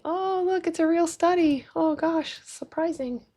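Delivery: high, put-on wonder; mock surprise. Streamer showing doubters a real study, mock-surprised. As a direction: feign amazement in a high little voice.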